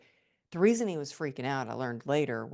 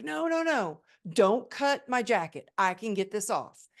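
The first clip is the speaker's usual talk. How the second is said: high, urgent protest that builds louder, slowing on the jacket line; frantic pleading; 7 semitones higher, 5 dB louder than usual. Retold or imitated. Injured woman pleading with paramedics not to cut her jacket, high and urgent. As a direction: beg them to stop, voice jumping up.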